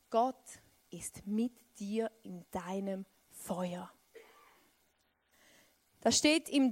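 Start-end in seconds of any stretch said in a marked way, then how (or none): 0.1-3.9 slow intimate whisper; whispered promise. Acted. Preacher whispers the promise to the listeners. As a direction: breathe it slowly to the congregation.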